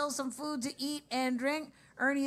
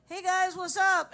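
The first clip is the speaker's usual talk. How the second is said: mock Skype greeting; 7 semitones higher, 4 dB louder than usual. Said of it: "just like".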